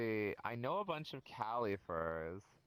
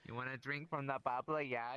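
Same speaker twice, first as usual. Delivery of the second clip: imitated Wisconsin accent. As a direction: echo it in a teasing Wisconsin accent. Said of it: "Give us your best Wisconsin accent".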